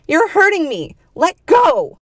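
high, loud, pained demand; shrill cry. A narrator's reading. Woman whose hand is crushed, shrill cry. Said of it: "the woman shrilled".

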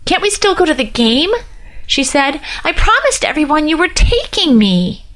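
high pleading whine; whiny plea to her date; miserable whine. Acted. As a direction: beg him, whining. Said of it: "The miserable whine of her words".

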